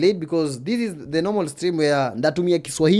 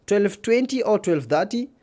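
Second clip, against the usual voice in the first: normal talking voice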